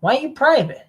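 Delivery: ordinary talk, nothing marked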